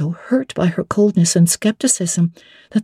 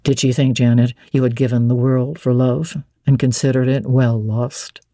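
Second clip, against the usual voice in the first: lower, firm gentleman's voice; low, assured nobleman addressing Jane; Rochester; 7 semitones lower than usual. Acted. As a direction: speak as the commanding master of the house.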